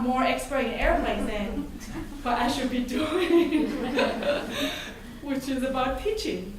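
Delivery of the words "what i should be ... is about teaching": laughing through the words; amused laugh breaking into speech. Real, not acted. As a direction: chuckle while finishing the thought. Laughs at own joke.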